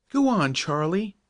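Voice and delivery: high, pleading coax; entreating. Acted. Joe pleading with the stubborn horse: high coaxing call. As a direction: coax the old horse to move on. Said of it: "entreated Joe".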